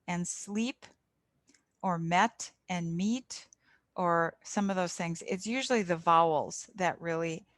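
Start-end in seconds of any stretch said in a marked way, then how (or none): 0.3-0.9 sleep with a long ee; long vowel contrast. Shown as a demonstration. Tutor contrasting sleep with slip, slow and careful. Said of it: "what I was just repeating". 2.0-3.5 met short e, meet long ee, slowly; drawn out, met then meet; minimal pair, slow. Shown as a demonstration. Tutor modeling vowel pairs for learners, slow. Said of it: "what I was just repeating".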